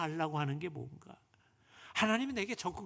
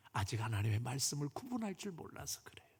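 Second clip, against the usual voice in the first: ordinary delivery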